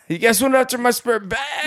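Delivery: high incredulous shout; mock outrage. Santa finding his bag raided, high mock shout. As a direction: shriek the accusation in mock disbelief.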